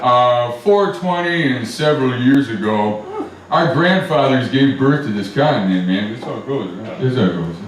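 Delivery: lazy laid-back stoner Lincoln, man; Tommy Chong voice. Acted. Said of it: "sounds exactly like Tommy Chong".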